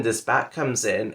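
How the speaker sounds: ordinary talk, nothing marked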